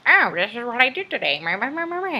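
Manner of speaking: high, loud, singsong reading of a dull message; mocking the flat tone of a text message. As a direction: parody a bland written update, loud and high. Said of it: "not the same way as how I say it".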